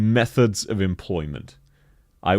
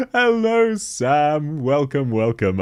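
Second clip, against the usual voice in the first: excited greeting; 7 semitones higher, 3 dB louder, slower than usual. Real, not acted. Greeting a viewer, high.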